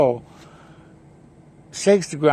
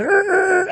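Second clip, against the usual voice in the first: high, stuck stammering er er; mocking high stammer. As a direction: imitate someone stuck for words, pitched way up. Mock stammer in retold argument.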